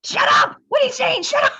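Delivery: hammed-up cringing yell; yelling at old self. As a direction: scream at your old videos in disbelief.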